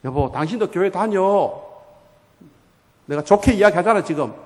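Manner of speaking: loud, exasperated nagging; acted scolding of a husband; angry wife. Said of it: "막 화를 내고".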